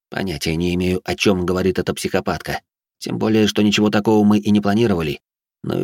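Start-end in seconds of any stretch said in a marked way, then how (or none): none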